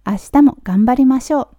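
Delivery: usual speaking voice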